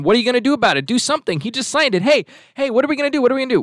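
high-pitched, urgent impression of pestering employees; mock agitated clamour. Employees demanding Disney act on the bill, mock agitation.

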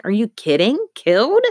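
can't believe what she heard; alarmed disbelief climbing to a high cry; shocked. Acted. Girl shocked by the word.

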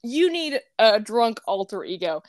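usual speaking voice

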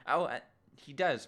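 ordinary talk, nothing marked

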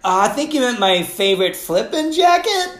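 primly fix the swear word like a fussy teacher; prim correction. Retold or imitated. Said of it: "and so I said".

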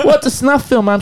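put-on high, laughing childish plea; childish pleading. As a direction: play the tugging little kid. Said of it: "I'm like".

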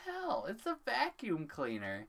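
very high, incredulous squeal; high-pitched comic incredulity; mock disbelief. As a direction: squeak it in baffled mock outrage. Mock amazement at vacuum expert.

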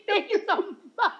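thanks bubbling with laughter, high; laughing while thanking him. Retold or imitated. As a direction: giggle through the thank-you. Laughing retelling her thanks.

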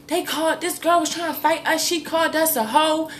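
put-on high, breathless kids reporting; excited kids.

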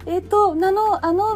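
cutesy burikko voice. Shown as a demonstration. 高い声の失敗例として見せる、ぶりっ子声. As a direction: put on a coy, cutesy act. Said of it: "ちょっとぶりっ子みたいな声になっちゃう".

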